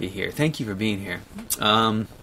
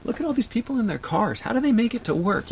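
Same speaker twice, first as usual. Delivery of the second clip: higher, incredulous self-quote; his younger self, baffled; 11 semitones higher than usual. Retold or imitated. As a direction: marvel at the other drivers on the way to work. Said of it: "being like".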